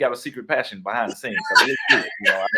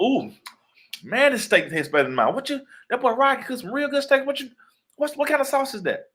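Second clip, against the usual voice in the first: high, loud excited mom voice; imitating his mom; 3 dB louder than usual. Mom tasting his steak, high and loud with delight. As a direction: gush about the steak like his impressed mother, pitched way up. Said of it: "she'd be like".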